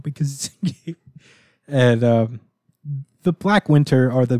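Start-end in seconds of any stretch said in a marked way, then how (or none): none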